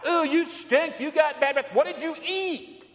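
high, squealing "ooh, you stink"; mock disgusted onlooker. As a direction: play the disgusted onlookers, hammed-up. Mocking others' disgust reaction.

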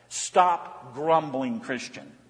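slow rebuke. Acted. Preacher rebuking gently.

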